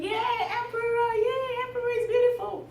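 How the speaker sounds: high, sustained mock cheering; crowd cheer. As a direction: cheer like the crowd, high without much volume. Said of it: "they were all shouting and going".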